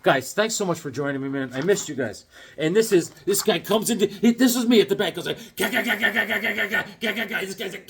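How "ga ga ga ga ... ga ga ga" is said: high, rapid run of "ga"; imitated gabbling noise. Man at the back of the drawing, comic high gabbling. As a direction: rattle off high staccato nonsense syllables. Acts himself in his drawing.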